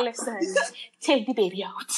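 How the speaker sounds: desperate whispered plea. Retold or imitated. Mother in labor begging the midwife, whispered. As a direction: whisper it, pleading through labor.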